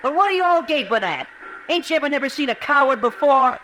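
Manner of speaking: high, raw cry of shame at them; bitter outburst. Acted. Danny to the staring townsfolk, high bitter outburst. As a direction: throw it back at the staring crowd.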